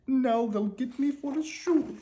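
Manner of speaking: high, slow terrified wail; frightened cry. A narrator's reading. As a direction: dread as he falls. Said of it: "he cried".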